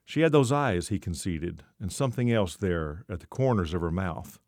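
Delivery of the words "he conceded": usual speaking voice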